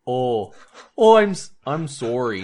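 Canadian apology that slips toward Irish; mock Canadian accent. Friend mocking Canadians on a podcast, near-Irish Canadian accent. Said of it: "that was almost, uh, Irish".

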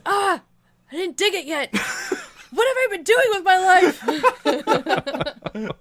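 dismayed. Retold or imitated. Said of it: "he opens the door and goes".

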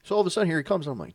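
ordinary talk, nothing marked

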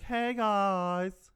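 mock coy, stretched-out hello; wheedling girl. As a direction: imitate a girl angling for a favor. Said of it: "You know how they do it".

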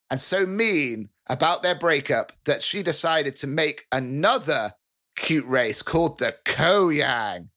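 ordinary talk, nothing marked

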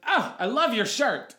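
light, high, drawn-out imitation of a flirty guy; flirty passing compliment. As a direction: toss off the compliment sweetly and slowly as you pass. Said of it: "And he goes".

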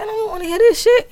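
high yelled quote; shouting. Retold or imitated. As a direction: yell it out high. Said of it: "I said".

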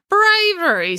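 high, loud incredulous echo; scornful snort. A narrator's reading. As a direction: throw the word back with a snort. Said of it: "snorted April".

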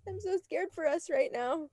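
mock scared. Mock panicked whimper.

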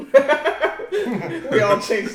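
loud, slow words through laughter; laughing tease. Real, not acted. Co-host mocking everyone's switch to joyful tears, laughing. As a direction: crack up while teasing everyone. Teasing the group's changed answer.

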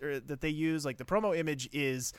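normal talking voice

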